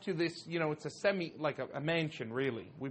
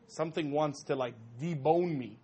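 ordinary talk, nothing marked

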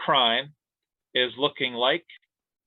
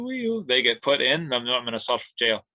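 usual speaking voice

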